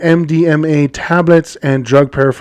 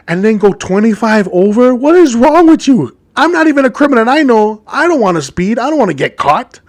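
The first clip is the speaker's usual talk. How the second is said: high-pitched incredulous rant; exasperated scolding; 7 semitones higher than usual. Acted. Podcaster berating a careless drug runner, exasperated. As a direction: scold in disbelief, voice riding up.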